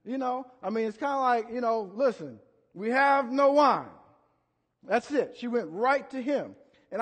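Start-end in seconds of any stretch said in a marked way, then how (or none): 1.9-3.9 slow, blunt and louder; to the point. Retold or imitated. Mary telling Jesus plainly, slow blunt demand. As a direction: get straight to the point. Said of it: "she was very to the point".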